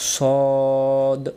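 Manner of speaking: সদ with a long rounded "o"; correct articulation. Shown as a demonstration. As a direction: hold সদ as "sawwwd", full and round. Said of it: "সদ অক্ষরটা আমরা আদায় করবো কীভাবে". (সদ, গোল মুখে টেনে আদায়)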